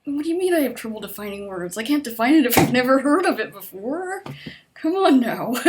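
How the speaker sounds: fast, then rising frustration; frustrated loud complaint; exasperated. Retold or imitated. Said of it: "I'm just like".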